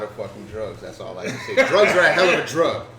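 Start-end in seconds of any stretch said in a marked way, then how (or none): 1.7-2.9 loud joke. Real, not acted. Joking loudly about drugs.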